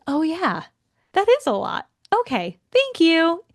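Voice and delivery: high-pitched, acted gratitude; relieved realization. Acting her reply to the chatbot, relieved and grateful.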